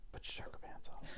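mock whisper, no voice. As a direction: breathe it out as a sly whisper. Echoes partner's line mockingly.

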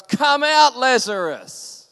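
high, very loud command, drawn out at the end; angry shout. Acted. Angry Jesus calls his dead friend from the tomb, angry shout. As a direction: bellow it in full anger, pitch leaping up. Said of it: "he's angry and he's shaking".

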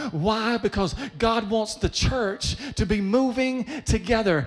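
impassioned preacher building to a shout; preaching at full force. Real, not acted. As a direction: build the sermon to its peak.

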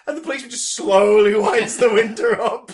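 usual speaking voice

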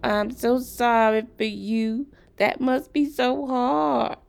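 loud, high, slow mock sympathy; nagging voice. A narrator's reading. Sarah imitates people pitying her hometown, nagging and mocking. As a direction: drag out the fake pity, high and loud. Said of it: "with the nagging voice".

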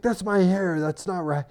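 high-pitched mock protest; retold indignant son. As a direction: protest like an offended boy. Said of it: "he gets so mad".

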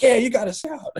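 high, laughing cheer. Retold or imitated. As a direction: whoop it with laughter. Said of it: "We're like".

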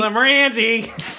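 high, drawn-out Philly Glenmorangie; Philadelphia accent. Shown as a demonstration. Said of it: "if you're from Philadelphia".

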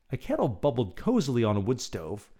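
ordinary delivery, nothing marked

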